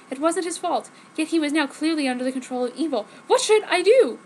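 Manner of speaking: ordinary talk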